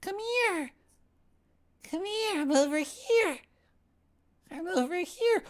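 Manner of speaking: very high, airy calling voice; distant beckoning voice. Acted. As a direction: call out sweetly from far away. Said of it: "a distant voice beckoning him".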